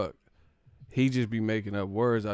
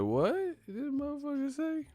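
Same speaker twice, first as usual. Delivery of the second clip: much higher, disbelieving falsetto; incredulous squeak; 4 dB quieter than usual. Real, not acted. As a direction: shoot up into a baffled falsetto. Incredulous at word choice.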